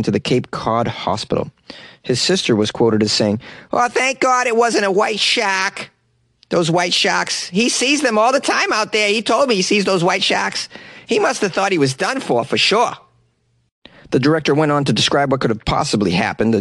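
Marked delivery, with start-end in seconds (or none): 3.7-12.9 put-on Boston accent. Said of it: "break out my Boston accent".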